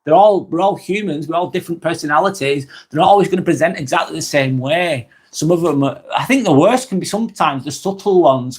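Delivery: ordinary talk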